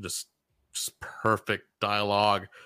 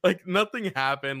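ordinary delivery

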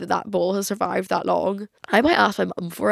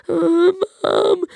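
faking sick; 10 semitones higher, 5 dB louder than usual. Acted. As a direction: moan pitifully for mum, hamming up the illness. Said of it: "I was quite an actress when I was younger".

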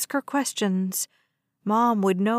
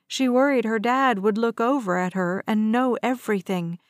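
plain reading voice

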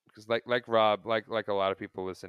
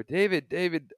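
calling out; 8 semitones higher, 7 dB louder than usual. Retold or imitated. Calling out to ballplayer as kid.

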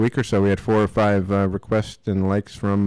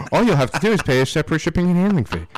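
fast, high fine-print rattle; infomercial; 7 semitones higher, 3 dB louder, much faster than usual. Pretend. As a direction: rush the small print.

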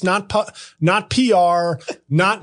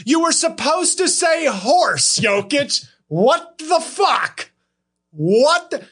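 mock outrage; 9 semitones higher, slower than usual. Mock outrage at Jokic's answer.

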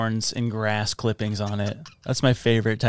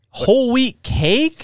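high, slow disbelieving question; incredulous; 10 semitones higher, much slower than usual. Real, not acted. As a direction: ask in total disbelief. Incredulous at the cake.

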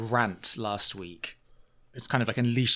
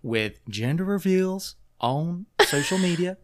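lightly voiced Oklahoma host; 6 semitones higher than usual. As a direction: drag it out like a prissy gossip. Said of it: "then they're like".

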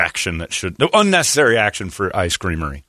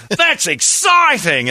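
mock thrilled; 14 semitones higher, 4 dB louder, much slower than usual. Voicing thrilled dater.

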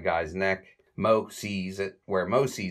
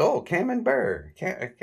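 high, slow, gushing delight; delighted Curly; 12 semitones higher, 4 dB louder, slower than usual. Retold or imitated. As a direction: savour the word in a high squeal. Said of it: "He says".

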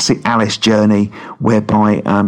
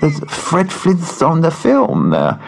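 Bond villain with a lisp; 6 semitones higher than usual. Retold or imitated. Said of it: "I sound more like a Bond villain with a lisp".

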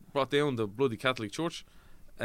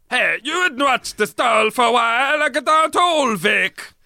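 shouted, high mock Cockney; Cockney accent; 13 semitones higher, 14 dB louder than usual. Shown as a demonstration. Actor showing hosts his Cockney accent, absurdly loud and high. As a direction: bellow it in a hammed-up East End accent. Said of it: "Cockney accent".

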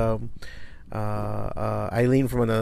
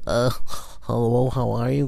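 snotty voice. Retold or imitated. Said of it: "that kinda snotty ... kind of voice".

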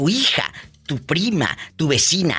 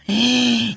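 short theatrical gasp; mock gasp; 6 semitones higher than usual. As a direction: gasp in playful shock. Host reacts to gothic wedding.

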